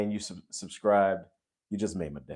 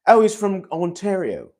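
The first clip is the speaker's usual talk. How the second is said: high, loud, badly faked British accent; hammed-up fake British voice; terrible British accent; 10 semitones higher, 7 dB louder than usual. Shown as a demonstration. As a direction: put on an awful British accent, pitched way up. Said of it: "that's the most terrible British accent ever".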